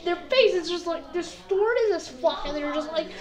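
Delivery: usual speaking voice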